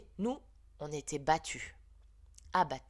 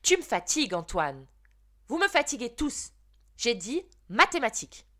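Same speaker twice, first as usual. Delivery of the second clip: higher and louder, fed-up scolding; irritated teacher; 5 semitones higher, 8 dB louder than usual. Acted. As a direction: lose patience with the whole class. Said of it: "Madame Balin s'est fâchée".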